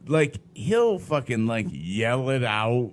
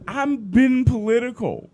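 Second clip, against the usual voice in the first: ordinary talk, nothing marked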